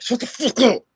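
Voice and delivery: high, loud, forceful imitation of being yelled at; retold angry shout. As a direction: snap it out as a retold yell. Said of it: "He was literally like that".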